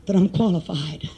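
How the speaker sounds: low, halting voice of a troubled pastor; imitated reluctant confession. Pastor confessing doubt to a visiting preacher, imitated reluctant confession. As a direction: retell his admission low and haltingly.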